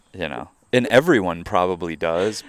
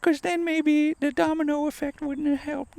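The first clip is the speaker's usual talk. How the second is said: tiny, very high mock-regretful voice; fretting friend impression. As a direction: squeak it out as a silly worrier. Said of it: "and she'll be like".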